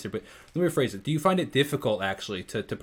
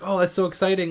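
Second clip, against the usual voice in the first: high, loud fan enthusiasm; imitated excitement; 7 semitones higher, 6 dB louder than usual. Host retelling his friend's reply to the singer, imitated excitement. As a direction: act out a friend's delighted reaction.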